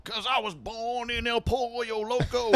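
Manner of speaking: mock folksy singer. Mocking Californian band.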